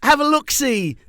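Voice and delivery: hammed-up British accent; mock British toff. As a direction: chirp it like a London gent. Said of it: "talk like that the rest of the episode".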